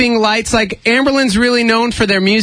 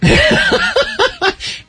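laughing. Real, not acted. Laughs at kittens remark.

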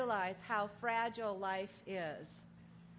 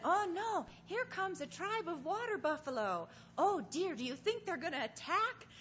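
high, fretting South African tourist voice; South African accent; 7 semitones higher than usual. Retold or imitated. Speaker imitating tourist watching buffalo arrive, high worried South African accent. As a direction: mimic a worried South African onlooker, pitched up. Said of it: "southern African accent".

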